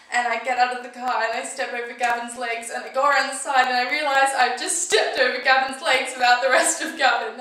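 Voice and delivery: high, loud, unbroken outpouring; horrified panic. Acted. Recounting finding Gavin's body after the crash, horrified panic. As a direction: pour it out in mounting shock.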